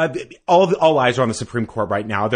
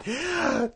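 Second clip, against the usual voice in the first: high, sharp mock gasp; 7 dB quieter than usual. Mock judge deciding.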